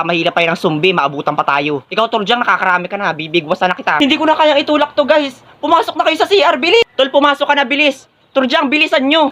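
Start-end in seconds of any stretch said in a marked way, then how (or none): 4.0-9.3 high, hurried calling; urgent panic. Acted. Student holding off zombies, urging friends, panicked. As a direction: urge them on in a strained high voice.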